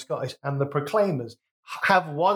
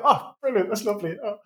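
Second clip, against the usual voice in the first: put-on higher-pitched Northeast English accent; 7 semitones higher than usual. Apologetic podcaster mimicking people from the Northeast, high-pitched Northeast accent. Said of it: "really sorry for anybody from the Northeast".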